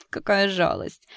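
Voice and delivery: catty singsong. A narrator's reading. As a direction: sing it out with fake sympathy. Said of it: "пропела Кэти".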